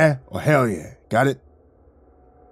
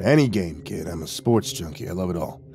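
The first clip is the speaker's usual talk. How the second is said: low, casual tough-guy mutter; lightly voiced gruff sports junkie; 4 semitones lower, 3 dB quieter than usual. Uninvited guest to kid about sports, casual low mutter. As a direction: lightly play an easygoing heavy, pitched down.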